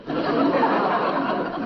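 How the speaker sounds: an airy laugh at her insult; unvoiced chuckle; breathy laugh. Real, not acted.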